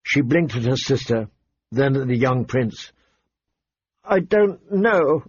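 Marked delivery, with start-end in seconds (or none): 4.0-5.2 put-on high, slow tearful girl. A narrator's reading. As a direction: draw it out helplessly, close to tears. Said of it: "she said tearfully".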